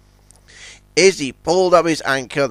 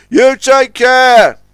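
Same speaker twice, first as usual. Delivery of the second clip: high, loud, drawn-out caution; Scuttlebutt warning; 10 semitones higher, 9 dB louder, much slower than usual. A narrator's reading. Scuttlebutt sends O J on his way, warning. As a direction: stretch out an ominous warning. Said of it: "warned Scuttlebutt Pete".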